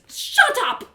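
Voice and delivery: high, loud snapped shut up; angry snap. Acted. Trinia snapping at her goading partner, angry. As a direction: cut him off sharply.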